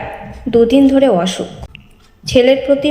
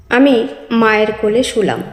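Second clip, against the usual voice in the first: ordinary delivery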